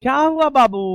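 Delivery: high, loud, sing-song soothing. Real, not acted. Soothing a crying little girl. (रोती बच्ची को पुचकारना)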